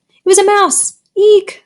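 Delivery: high mock scream of girls. A narrator's reading. Girls see a mouse on the desk, screaming. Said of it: "screamed all the girls".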